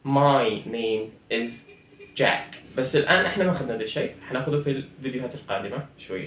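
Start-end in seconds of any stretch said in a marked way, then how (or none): 0.1-2.6 My name is Jack drawn out very slowly; teacher modelling the answer; slow, clear classroom drill; slow model answer. Shown as a demonstration. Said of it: "الجواب بيكون".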